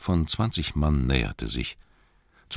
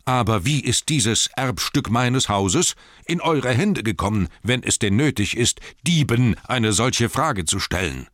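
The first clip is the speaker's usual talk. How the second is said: raised, forceful indignation; angry accusation; 7 semitones higher, 5 dB louder than usual. A narrator's reading. Thorin asks thieves about his heirloom, enraged. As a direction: demand an answer in high, angry tones. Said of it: "Seine Stimme war heiser vor Wut".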